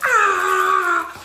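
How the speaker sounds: very high, loud shout of release; shouting with joy at the end of the retreat; ecstatic yell. Retold or imitated. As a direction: let out a huge cathartic cry.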